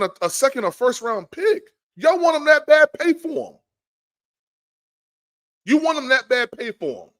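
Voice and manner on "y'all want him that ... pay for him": loud, high dare to the rival fans; challenging shout. Real, not acted. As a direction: throw it at them like a challenge. Daring rival fans to pay.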